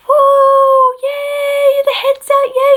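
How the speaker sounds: high, loud mock cheer; imitated cheering. Whole room as the head comes out, imitated cheering. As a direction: whoop it high like a celebrating crowd. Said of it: "the whole entire room was going".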